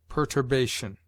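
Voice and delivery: normal talking voice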